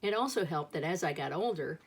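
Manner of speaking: usual speaking voice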